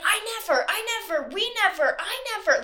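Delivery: put-on high, childish whine of denial; mocking a boy's high whiny protest; whiny repeated denial. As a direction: keep whining it like a stubborn kid. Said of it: "he would continuously say that over and over".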